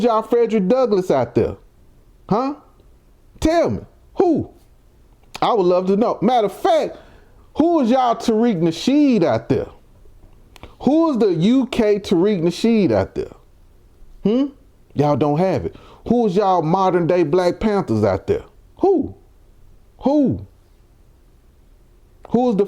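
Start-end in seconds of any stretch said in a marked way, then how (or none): 2.3-4.5 high, insistent challenge; high taunting demand. Real, not acted. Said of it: "demanding an answer". 18.8-20.5 sharp high taunting question; high mocking who. Real, not acted. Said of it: "repeated taunt".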